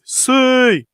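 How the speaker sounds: high, drawn-out "siuu" victory call; celebration cry. Acted. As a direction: let out a footballer's celebratory whoop. Celebration cry closing his prediction.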